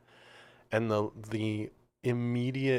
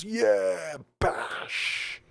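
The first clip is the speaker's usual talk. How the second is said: high, loud mock crowd roar; concert crowd cheering; 9 semitones higher, 3 dB louder than usual. Led Zeppelin audience answering [name], mock rowdy cheer. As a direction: imitate the drunk audience shouting back. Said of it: "Everybody goes".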